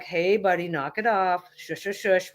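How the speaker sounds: low, gently reassuring command to a dog; calm and low. Shown as a demonstration. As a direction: quietly settle the dog, pitch down. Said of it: "my voice gets a little low and slow".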